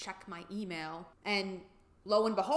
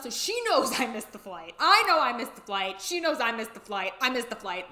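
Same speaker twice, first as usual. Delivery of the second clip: louder, building distress; rising upset insistence; 3 semitones higher, 7 dB louder than usual. Real, not acted. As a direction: hammer each missed flight, upset. Panicked recap of missed flight.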